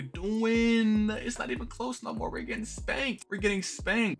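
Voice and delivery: high, drawn-out cry of despair; exasperated wail. Real, not acted. Gamer getting spanked, wailing in exasperation. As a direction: stretch the question out, voice way up. Despairing as the team gets beaten.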